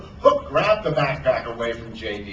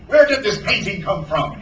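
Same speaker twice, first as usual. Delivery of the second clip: raised-pitch demand; 5 semitones higher, 4 dB louder than usual. A narrator's reading. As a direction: demand an answer as a captain. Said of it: "he demanded".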